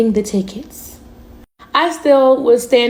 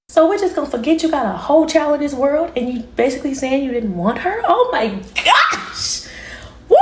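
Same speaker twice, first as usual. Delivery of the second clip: outraged disbelief; 4 semitones higher than usual. Real, not acted. Reacting to the boyfriend's text, building to a laughing outburst. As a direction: let the disbelief burst into a laugh.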